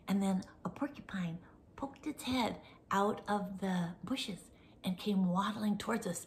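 whispered suspense narration; hushed storytelling. A narrator's reading. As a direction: tell it softly to build suspense.

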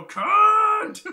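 drawn-out cry. Shown as a demonstration. Director showing actor the final swear, drawn-out cry. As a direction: wail it out long and high. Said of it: "Now just go".

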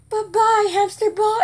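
high, sing-song mock goodbye; chirpy pretend bye-bye; playful farewell. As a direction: pitch it way up like a cutesy send-off. Acting out bowling the ball away.

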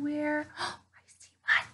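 slow hushed whisper; excited little spotting whisper. Acted. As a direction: whisper so the bird won't fly. Spotting a bird quietly.

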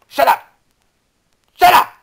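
sharp, high, loud outburst; irritated shouting. Real, not acted. Gamer tells the screamer to stop, irritated shout. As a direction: shout it short and hard.

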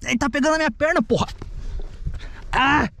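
high, frantic cries; panicked. Real, not acted. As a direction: yell in panic as your leg is grabbed. Something grabbing his leg.

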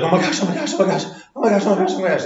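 high, breathless mock panic; panicked friend. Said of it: "was like".

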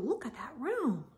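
ordinary delivery, nothing marked

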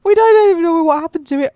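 hammed-up wail of injustice; mock outrage. Mock outrage over dead horse.

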